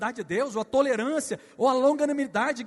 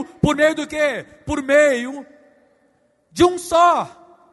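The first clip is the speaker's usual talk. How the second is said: louder, higher, slow and drawn out; preaching emphasis; 5 semitones higher, 9 dB louder, much slower than usual. Real, not acted. Pastor to church, slow loud drawn-out emphasis. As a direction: hold it up and stretch it.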